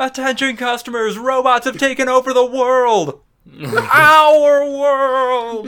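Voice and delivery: high, loud mock PA; robot announcement. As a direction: announce the takeover dramatically.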